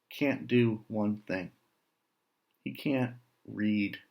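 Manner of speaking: ordinary delivery, nothing marked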